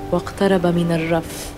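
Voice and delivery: usual speaking voice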